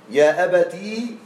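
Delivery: يا أبتي said slowly with a rising ending; أبتي drawn out, rising at the end; slow demo of أبتي. Shown as a demonstration. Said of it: "آه، أبتي". (يا أبتي ببطء مع نغمة صاعدة)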